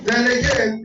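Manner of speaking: usual speaking voice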